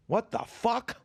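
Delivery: high-pitched outraged outburst, retold; appalled professor.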